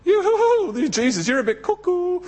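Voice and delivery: high, laughing scoff; mocking laughter. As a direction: laugh him off as crazy. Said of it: "They laughed at Jesus".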